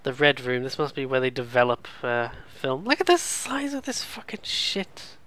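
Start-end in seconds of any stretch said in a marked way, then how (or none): 2.9-5.2 amazed. Real, not acted. Awed by huge room.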